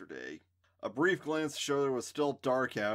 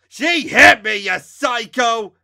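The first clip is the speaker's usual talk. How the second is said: high, loud outraged shout; 12 semitones higher, 21 dB louder, slower than usual. A narrator's reading. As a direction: yell it, furious. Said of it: "Mikey shouted".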